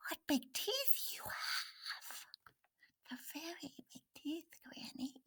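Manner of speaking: put-on hushed, whispery little girl; timid awe. A narrator's reading. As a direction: shrink to a near whisper.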